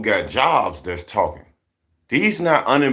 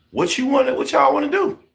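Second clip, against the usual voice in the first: exasperated high question; 13 semitones higher than usual. Real, not acted.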